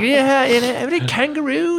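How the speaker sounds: lightly put-on Aussie order; mock Australian accent. Ordering at the taco counter in mock Australian.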